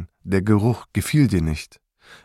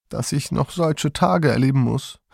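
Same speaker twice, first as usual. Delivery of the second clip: put-on groaning lament of an old woman, a little higher; moaning grandmother; 5 semitones higher than usual. A narrator's reading. Grandma laments being shut in the truck, moaning. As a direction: moan it out like a despairing grandma. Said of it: "stöhnte Oma".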